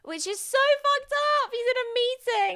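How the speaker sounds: very high, loud squeal of disbelief; scandalized squeal. Real, not acted. Podcaster reacting to an outrageous scene with co-host, scandalized squeal.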